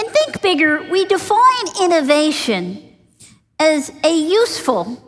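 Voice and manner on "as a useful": "useful" pushed out with weight; stress on useful. Shown as a demonstration. Teacher defining innovation, stressing "useful". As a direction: lean hard on "useful". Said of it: "emphasis on the word useful".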